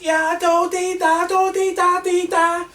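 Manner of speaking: high, loud sing-song Scouse babble; squeaky, loud Scouse gibberish; mock Scouse. Mimicking a Scouse camp worker, high loud Scouse babble. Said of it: "like, a Scouse who was".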